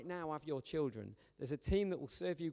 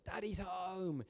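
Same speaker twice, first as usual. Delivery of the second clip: higher, drawn-out mock squeal of greeting; imitated excited child; 4 semitones higher, much slower than usual. Six-year-old daughter greeting her father, imitated excitement. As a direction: imitate a small child thrilled to greet a parent. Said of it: "she was all excited".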